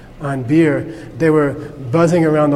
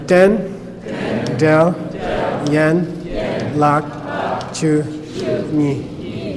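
den, del, yen, lak, chu, ni syllable by syllable; a pause after each of den, del, yen, lak, chu, ni; real slow; much slower than usual. Shown as a demonstration. Teacher leads class through Tibetan syllables, real slow. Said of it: "We'll go real slow".

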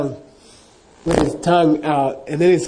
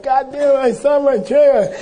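excited; 11 semitones higher, 5 dB louder than usual. Retold or imitated. Hermit finally sees his teacher.

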